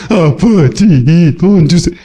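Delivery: slow, sulky plea with no joy; gloomy mumbled begging. Pretend. Preacher shows the joyless way to ask God for money. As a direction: drag the words out like a reluctant child. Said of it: "그냥 팻".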